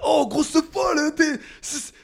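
high, drawn-out Marseille accent building to a shout; hammed-up Marseillais losing his temper; angry Marseille accent. Shown as a demonstration. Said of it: "avec l'accent marseillais". (accent marseillais énervé)

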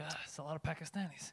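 very soft, low murmur in mock dismay; muttered aside. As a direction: mutter it under your breath. Voicing royals looking around London.

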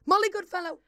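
high, loud realisation; horrified realisation. Real, not acted. Remembering the guest they forgot, horrified blurt. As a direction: blurt it out, aghast.

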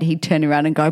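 ordinary delivery

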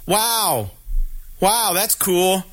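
imitated sarcastic wow, drawn out slow; sarcastic customer. Lady behind me reacting to the balloons, sarcastic imitation. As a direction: stretch the words in mock admiration.

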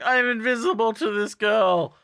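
high-pitched mock complaint; mock exasperation. Teacher ignored by fleeing student.

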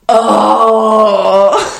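loud, high cringing "oh"; re-enacted groan of cringe; vocalized cringe groan. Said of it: "Vocalized it".

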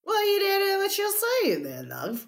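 hammed-up Australian, sinking at the end; Australian accent. Shown as a demonstration. Cohost showing off an improved Australian accent. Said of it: "Oh, that's good".

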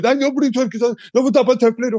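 high-pitched fussing grandmother; imitated scolding. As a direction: order a child about in a mock high voice. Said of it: "hun kjefter meg ned". (etterlignet masete, kjeftende bestemor)

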